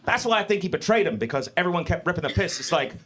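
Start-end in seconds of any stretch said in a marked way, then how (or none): none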